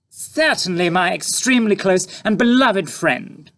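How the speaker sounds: falsely charming to the tramp; sickly sweet mother. A narrator's reading. Mother fawning.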